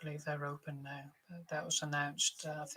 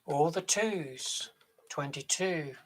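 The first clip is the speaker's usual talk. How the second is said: loud, drawn-out bingo calls; bingo caller; 9 dB louder, slower than usual. Acted. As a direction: call the numbers across the hall. Bingo caller calling numbers.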